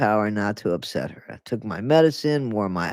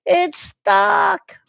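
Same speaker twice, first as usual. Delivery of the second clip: squeaky repeat, much higher; silly high voice; 5 dB louder than usual. Pretend. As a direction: toss it off in a cartoon squeak.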